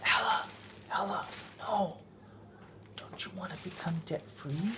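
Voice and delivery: put-on hushed, soft little-boy plea; mock whisper. Dad retelling son whispering to sister, soft and hushed. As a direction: lean in and hush it like a kid. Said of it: "whisper to Ella".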